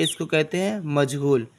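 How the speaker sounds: ordinary talk, nothing marked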